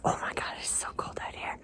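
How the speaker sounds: quick breathless whisper. Real, not acted. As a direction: whisper fast, almost to yourself. Shivering on log in cold.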